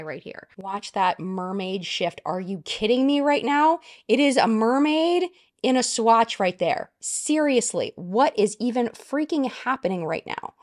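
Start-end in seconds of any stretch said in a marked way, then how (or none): 2.2-10.5 higher and louder disbelief; real excited disbelief; excited gush. Real, not acted. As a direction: rave like you can't believe your eyes. Gushing over the shade shift.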